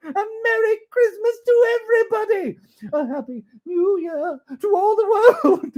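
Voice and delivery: very high, loud, laughing and tearful; joyful greeting. Acted. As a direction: call out to the whole world. Scrooge calling greetings.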